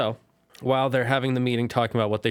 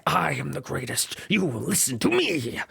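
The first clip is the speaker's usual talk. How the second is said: gloating tyrant; 2 semitones higher than usual. Retold or imitated. Ozai gloating over the weak, in a lightly mocking tyrant voice. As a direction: lord it over them.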